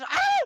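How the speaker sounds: one piercing high shriek of pain. Retold or imitated. Patient screaming again in the doctor's office.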